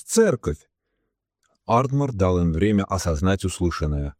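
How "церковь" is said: high, sudden cry of discovery; eager outburst; excited exclamation. A narrator's reading. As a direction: call out the answer, pitch leaping up. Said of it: "воскликнул Томас".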